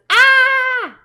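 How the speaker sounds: loud, high "Aah!"; freaked-out scream. Acted. Frog reacts to the biting bird, freaked-out scream. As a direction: let out a panicked shriek. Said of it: "like freaking out".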